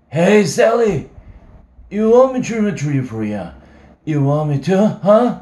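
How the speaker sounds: put-on drunk shouting.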